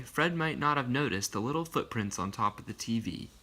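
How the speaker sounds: ordinary delivery, nothing marked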